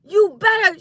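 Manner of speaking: high, loud mock scolding; spazzing mom. Teller quotes mom warning her, mock spazzing. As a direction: imitate mom losing it. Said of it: "my mom started spazzing".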